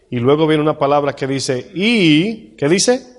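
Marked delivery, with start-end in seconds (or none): 1.7-3.1 prompting the crowd. Real, not acted. Prompts congregation for the word.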